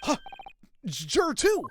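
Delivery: put-on the same old man voice, worked up; old man juror. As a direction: boast it like a feisty old juror. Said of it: "that's my typical old man accent".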